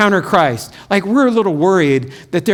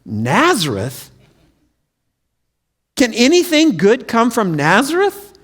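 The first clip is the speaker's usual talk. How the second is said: skeptical scoff; 3 semitones higher, slower than usual. Acted. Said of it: "His response to Philip is".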